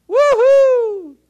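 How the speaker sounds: high, loud cheering whoop; excited whoop. Real, not acted. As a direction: whoop it up to fire up the crowd. Preacher rallying the congregation.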